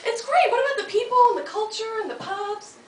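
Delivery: high, bright-eyed enthusing; gushing to skeptical locals about the pubs; excited gushing. Retold or imitated. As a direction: sell it like an eager newcomer.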